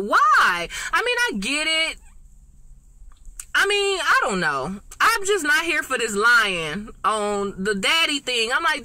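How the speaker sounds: ordinary talk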